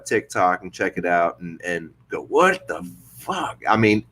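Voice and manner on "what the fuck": slow, stretched-out "what the" of disbelief; amazed reaction. Retold or imitated. Host recalling scrolling through his TikTok, drawn-out amazement. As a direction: draw it out, pitched up in mock amazement.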